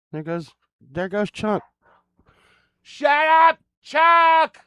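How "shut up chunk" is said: sharp high shout at the dog; yelling at dog. Real, not acted. Streamer yelling at his barking dog Chunk, loud and high. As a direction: snap at the barking dog to hush.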